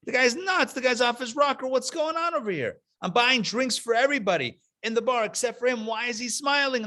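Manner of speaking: high, frantic exasperated rant; freaking out. Retold or imitated. The anti-Semite complains to the bartender, high and frantic. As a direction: sputter like a man losing it.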